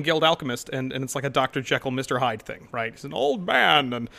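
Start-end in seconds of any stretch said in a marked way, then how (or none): 2.9-4.1 lightly voiced old man, a touch higher. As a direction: slip into your old character's voice. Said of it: "and I use my old man voice".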